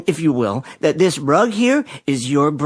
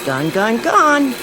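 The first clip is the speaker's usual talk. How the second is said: gleeful sales pitch; 7 semitones higher, slower than usual. Acted. Salesman showing off his vacuum to a housewife, gleeful. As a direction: sell the vacuum with rising glee.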